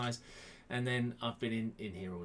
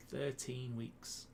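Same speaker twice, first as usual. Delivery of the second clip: slow, softer musing; slow and subdued, in your own pitch; drawn-out remark; 6 dB quieter, much slower than usual. Real, not acted. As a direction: say it slowly, drawing out each word. First take, slow and plain pitch.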